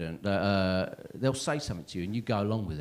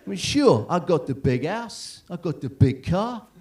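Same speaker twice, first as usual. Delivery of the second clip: put-on slow, louder, boastful Zimbabwean accent; 5 semitones higher, 6 dB louder, slower than usual. Guest contradicts himself boasting of house and car, exaggerated Zimbabwean accent. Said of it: "that is what he sounded like".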